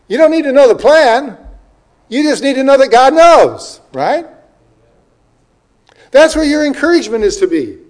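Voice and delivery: high, loud, insistent pulpit delivery; impassioned preaching. Real, not acted. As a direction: drive the point home to the congregation. Preacher drives point home.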